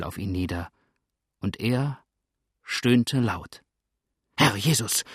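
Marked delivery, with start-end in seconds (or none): 4.4-5.0 frightened exclamation in a character's voice; a touch of alarm; startled cry. A narrator's reading. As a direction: cry out in shock, narrated with restraint. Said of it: "schrie da Jones erschreckt zurückfahrend".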